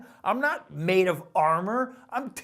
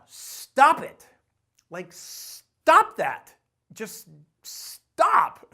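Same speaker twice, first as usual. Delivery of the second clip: a blunt loud stop; firm loud command; 7 dB louder, much slower than usual. Real, not acted. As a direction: order them to quit, hard. Telling viewers to quit self-doubt.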